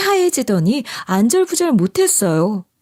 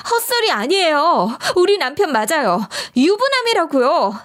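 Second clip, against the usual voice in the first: higher-pitched, insistent exposé; urgent outburst; 6 semitones higher than usual. A narrator's reading. Wife blurts out the truth.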